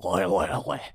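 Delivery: mock Scottish throat noises; throaty put-on Scottish oi; Scottish accent. Shown as a demonstration. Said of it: "That's how I do a Scottish accent".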